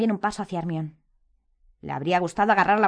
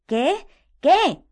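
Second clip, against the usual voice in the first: high, louder on the repeat; impatient exclamation; 11 semitones higher, 9 dB louder than usual. A narrator's reading. Harry presses his gaping friends, impatient. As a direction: demand an answer impatiently. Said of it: "exclamó Harry impaciente".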